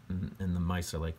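ordinary delivery, nothing marked

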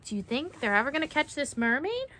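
usual speaking voice

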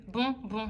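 tags 2-4 unchanged, the combined score is under 7; syllables split. Shown as a demonstration. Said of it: "il n'y a que deux syllabes".

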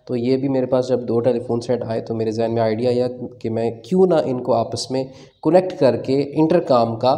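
ordinary talk

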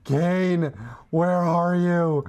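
loud, slow summoning; summoning call. Real, not acted. Gamer loudly summons Kayn, slow and drawn.

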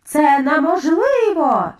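incredulous crowd shout; shouting in disbelief. A narrator's reading. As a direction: call it out, stretching the words. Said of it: "загукали відьми".

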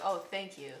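ordinary delivery

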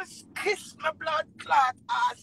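ordinary talk

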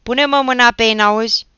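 high, very loud order; beside yourself with anger, shouting; exasperated shout. A narrator's reading. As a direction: yell at a brother who won't move. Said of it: "strigă Achim, scos din sărite".

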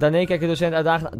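plain reading voice

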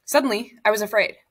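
usual speaking voice

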